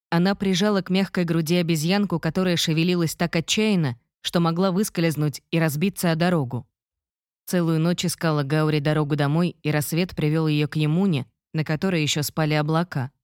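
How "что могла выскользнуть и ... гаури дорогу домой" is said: plain reading voice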